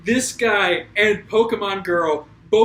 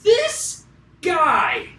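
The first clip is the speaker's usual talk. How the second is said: excited shout; 8 semitones higher than usual. Real, not acted. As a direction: yell it out with delight. Excited shout at gym leader.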